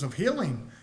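normal talking voice

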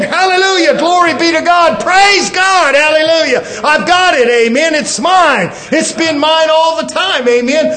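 ringing shouts of hallelujah, high and loud; preacher shows the congregation how to shout their praise. Shown as a demonstration. Preacher to congregation, loud joyful shouted praise. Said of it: "You should be saying".

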